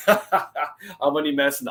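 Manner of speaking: laugh, then admit the tease; real laugh into a slow, joking reassurance. Real, not acted. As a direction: let the joke go with a laugh. Teasing Mister B, admits joking.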